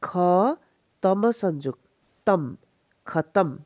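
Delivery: ख alone, pause, तम, then खतम joined; careful teacher's breakdown of ख and तम; separate syllables. Shown as a demonstration. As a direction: sound out खतम in two parts. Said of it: "ख को अलग से साउंड करेंगे".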